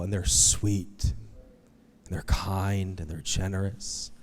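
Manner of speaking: gentle fading warmth; tender. Real, not acted. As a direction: soften into kindness. Describing kind old couple.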